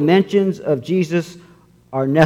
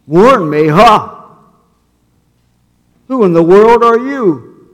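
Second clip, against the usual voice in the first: startled burglar; 6 semitones higher, 7 dB louder than usual. Acted.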